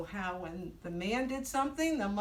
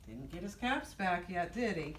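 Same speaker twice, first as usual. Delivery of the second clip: ordinary delivery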